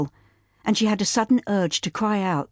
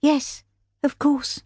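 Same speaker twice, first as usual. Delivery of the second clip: put-on higher, slow young girl's voice forcing calm; brave young girl; 9 semitones higher, slower than usual. Daughter reassures aunt, slow brave little voice. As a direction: hold it together, slowly. Caroline lying, holding her head high.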